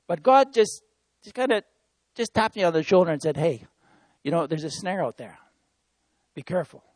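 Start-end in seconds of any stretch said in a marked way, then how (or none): 3.4-6.8 a hushed, softer caution; soft gentle warning. Retold or imitated. God gently warning the preacher about a snare, softly. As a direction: lean in and warn them quietly.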